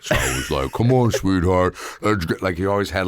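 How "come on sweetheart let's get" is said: round, stuffed-cheek big man; louder, rounded cartoon dad; fat dad voice. Shown as a demonstration. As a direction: talk like a heavy dad with food stored in his mouth. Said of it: "what I thought was fat".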